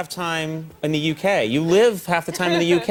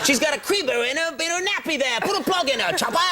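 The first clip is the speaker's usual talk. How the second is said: higher, hammed-up cockney put-on; lightly playing a chimney sweep Brit; a hint of a silly British nanny; mock English accent; 10 semitones higher than usual. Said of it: "talks like that in England".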